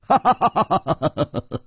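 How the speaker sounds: hearty laugh falling away; big laugh. Acted. Chu Liuxiang laughing at Nan Gongling, big laugh. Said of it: "楚留香大笑".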